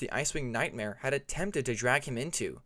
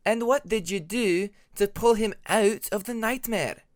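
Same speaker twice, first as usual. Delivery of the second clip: put-on higher, louder questioning young dragon; Ermine's voice; 8 semitones higher, 6 dB louder than usual. A narrator's reading. Ermine demands to know what Tempest did, higher and louder. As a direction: press Tempest for an answer, pitched up.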